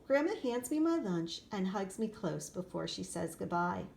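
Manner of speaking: usual speaking voice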